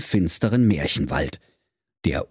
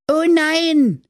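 put-on much higher dismayed children crying out; dismayed kids; 5 dB louder than usual. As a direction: cry out in cartoon dismay. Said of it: "riefen da die Kindelein wie aus einem Munde".

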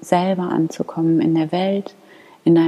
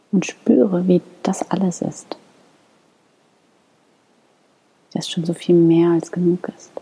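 guided meditation. Acted. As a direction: lead them gently into calm prayer. Leads a guided morning prayer.

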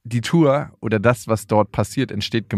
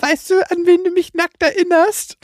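put-on high, curious little-girl voice; imitating his daughter; 4 dB louder than usual. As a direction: ask it like an innocent five-year-old. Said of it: "meine Tochter ... meinte".

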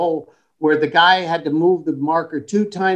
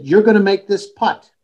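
emphatic, higher-pitched coaching; retold pep talk; 5 semitones higher, 4 dB louder than usual. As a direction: fire up the golfer before the putt.

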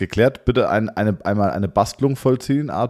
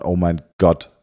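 ordinary talk

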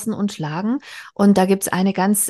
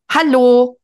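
a loud, higher, nagging snap of hello; shrill scolding; 5 semitones higher, 8 dB louder than usual. Acted. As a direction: bark it at her mother to get an answer. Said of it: "keifte Mathilda".

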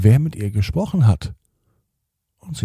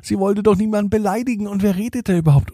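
flustered; 10 semitones higher, faster than usual. Acted. Bella's flustered thoughts.

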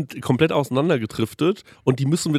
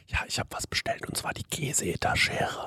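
hushed whisper, no voice; 11 dB quieter than usual. Retold or imitated. Telling the waitress during the film, whispered. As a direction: whisper so the film isn't disturbed.

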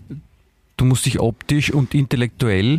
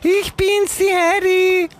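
very high squeaky cartoon girl voice; much slower than usual. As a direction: chirp it like a cartoon Heidi. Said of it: "sie reden beide wie Zeichentrickfiguren".

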